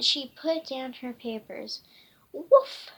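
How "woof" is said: loud high woof; dog bark. Acted. Storyteller barking as the dog Dixie. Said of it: "and Dixie barked".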